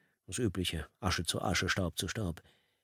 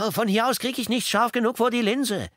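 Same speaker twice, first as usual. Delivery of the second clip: protesting; 5 dB louder than usual. A narrator's reading. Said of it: "protestierte Porter".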